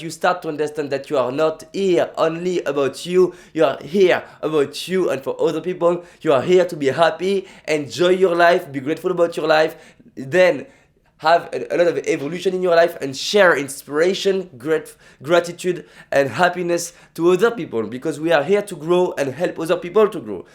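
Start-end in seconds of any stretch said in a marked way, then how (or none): none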